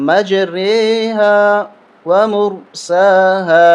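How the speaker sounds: مجریہا ومرساہا very slowly, imala on ر; slow demo. Shown as a demonstration. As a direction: draw out مجریہا ومرساہا with the tilted e-like vowel. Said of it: "کس طرح پڑھیں گے؟".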